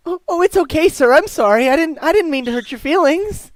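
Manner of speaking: put-on high, loud, anxious little boy; worried young boy. Boy apologising to a stranger, flustered and young.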